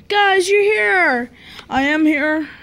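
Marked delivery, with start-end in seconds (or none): 0.1-1.3 high, drawn-out toy greeting; slow, high-pitched happy call; pretend excited greeting. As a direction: play a figure delighted to find friends. Toy figure greets arriving friends.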